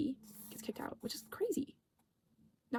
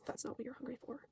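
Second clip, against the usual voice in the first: eerie whisper; 11 dB quieter than usual. Retold or imitated. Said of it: "Jackie says".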